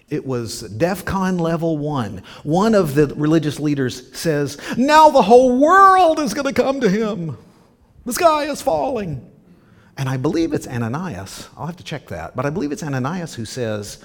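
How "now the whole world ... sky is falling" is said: loud, high-pitched panicked wail; mock meltdown. Religious leader panicking that all will follow Jesus, mock meltdown. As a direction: cry out in hammed-up alarm, far above your usual pitch. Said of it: "they went into high meltdown".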